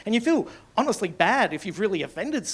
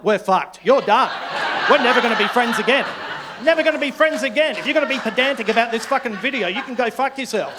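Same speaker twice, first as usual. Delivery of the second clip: loud, raised comic rant; mock furious; 3 semitones higher, 5 dB louder than usual. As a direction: break off the friendship in a huff.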